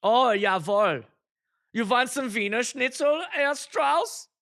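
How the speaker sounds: hammed-up German accent, high and slow; exaggerated singsong German accent. Shown as a demonstration. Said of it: "in the German accent".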